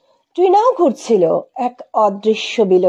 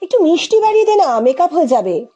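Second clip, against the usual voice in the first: helpful friend's suggestion; 7 semitones higher, faster than usual. Acted. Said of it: "কে যেন বলল".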